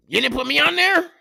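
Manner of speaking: high, fast, teasing complaint with laughter; mock-indignant friend. Friend teasing about being left out of the TikTok montage, high laughing mock complaint. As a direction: play the buddy whining he got left out. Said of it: "He was like".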